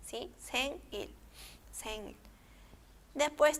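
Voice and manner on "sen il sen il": "sen, il" with N closing the first syllable; divided syllables. Shown as a demonstration. Teacher repeats divided reading for learners, "sen, il" in two syllables. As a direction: repeat "sen, il" without carrying N over.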